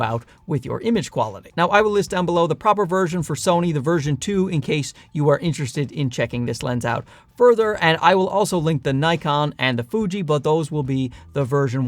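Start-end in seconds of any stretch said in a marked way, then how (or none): none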